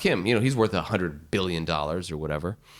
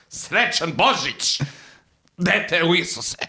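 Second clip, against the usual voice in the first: mock festive blessing; 8 semitones higher, slower than usual. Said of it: "Merry Christmas".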